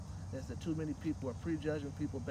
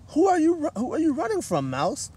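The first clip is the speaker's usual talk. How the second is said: high, louder animal voice; curious koala; 11 semitones higher, 14 dB louder than usual. Acted. Koala asks the mouse who she is running from, high. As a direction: ask it like a friendly koala. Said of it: "asked Koala".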